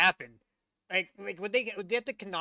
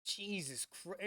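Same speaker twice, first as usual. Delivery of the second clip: ordinary talk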